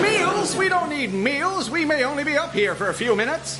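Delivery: high, loud exasperated refusal; indignant. Acted. Wright brother refusing the steward's meal, indignant. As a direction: scoff at the whole idea. Pilot rejecting the in-flight meal.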